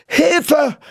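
high-pitched cry for help; frightened scream. A narrator's reading. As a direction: yelp in fright. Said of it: "schrie Viggo". (erschrockener Hilfeschrei)

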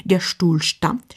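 ordinary delivery

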